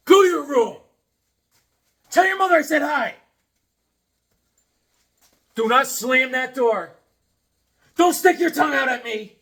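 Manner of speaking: high, loud mock-strict orders; mock stern parent. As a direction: scold the kid jokingly. Sends the daughter away, mock stern.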